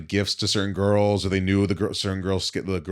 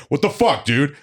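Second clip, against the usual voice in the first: loud, higher-pitched retold outburst; exasperated protest; 7 semitones higher, 6 dB louder than usual. Big bouncer complaining to his useless partner, exasperated. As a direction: demand an answer, voice raised.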